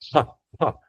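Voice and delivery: "ha" as a puff of breath. Shown as a demonstration. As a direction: breathe out "ha" in place of a K. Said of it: "like a breathing K sound".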